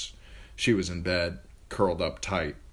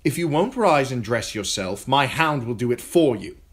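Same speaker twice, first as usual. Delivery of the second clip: high, louder menacing threat; threatening boy king; 7 semitones higher, 8 dB louder than usual. A narrator's reading. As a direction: threaten her with cold spite. Joffrey threatens Sansa.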